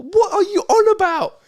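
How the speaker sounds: high, loud disbelieving outburst; loud mock-exasperated what are you on about; exasperated shout. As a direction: throw it out in baffled exasperation. Exasperated reply to drunk friend.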